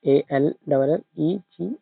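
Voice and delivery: letter by letter, slow; spelling out slowly. Shown as a demonstration. Teacher spelling the word slowly for students. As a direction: spell it out for the class. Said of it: "देखो, अगर इसमें से मैं देखो".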